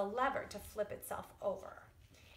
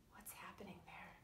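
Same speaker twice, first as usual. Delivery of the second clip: whispered wondering question; soft curious whisper to the class; hushed question; 3 semitones lower, 19 dB quieter, faster than usual. Acted. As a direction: draw the kids in with a whisper.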